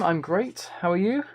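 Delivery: usual speaking voice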